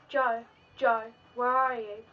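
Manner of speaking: loud desperate shout of a name; mother searching the woods, shouting for her son; frantic calling out. Acted. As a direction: call out for the lost son at full voice. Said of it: "Your mum screams".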